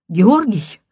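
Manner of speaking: a frantic caller checking the name; urgent, out-of-breath phone call; hurried woman's voice. A narrator's reading. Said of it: "прерывистый, торопящийся голос женщины".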